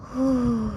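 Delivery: short breathy sigh of relief; demo sigh. Shown as a demonstration. Teacher shows the sound of a sigh, relieved breath out. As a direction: let out a quick relieved sigh. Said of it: "deydi-ku, xuddi o'sha narsa".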